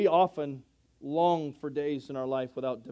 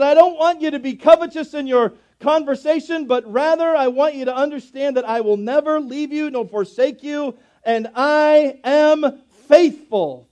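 loud, high preaching that rises and booms; full-force preaching; 10 semitones higher, 11 dB louder than usual. Acted. Preacher booming God's promise at the congregation. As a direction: preach it out to the whole room.

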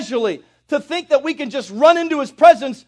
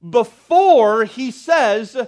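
"Before" drawn out slowly, louder; stress on Before; 5 dB louder, much slower than usual. Shown as a demonstration. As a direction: lean hard on "Before". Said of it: "preacher stresses word".

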